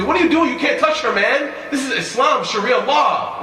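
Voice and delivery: loud, high, hammed-up scolding; mock yelling. Preacher imagining the Prophet yelling at Mughith, mock yell. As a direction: yell it as a caricature of outrage. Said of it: "He didn't yell at".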